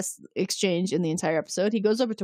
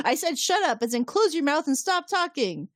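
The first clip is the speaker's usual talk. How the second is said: high-pitched mock scolding; angry insistence; 8 semitones higher than usual. Host voices Riker telling Picard to stop talking, angry. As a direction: spell it out in a high, acted temper. Acted Riker snapping again.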